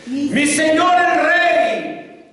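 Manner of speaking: a long, high call to the king. A narrator's reading. David calling after King Saul, slow high call. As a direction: call after him across the distance, slow and drawn out. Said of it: "dio voces detrás de Saúl".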